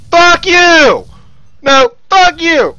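drawn-out, high furious curse; angry yelling. Real, not acted. Gamer raging at a kill stealer, angry yelling. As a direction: yell it back, stretching the words. Raging at the player taking kills.

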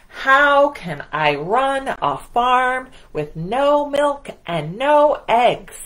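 loud, slow angry complaint; furious. Acted. Farmer Brown furious at the animals, slow loud complaint. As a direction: demand an answer in fury.

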